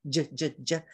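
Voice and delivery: j, j, j buzzed with voice; voiced j. Shown as a demonstration. Teacher drilling the new j sound. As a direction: repeat j with the voice on. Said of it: "My new sound is j".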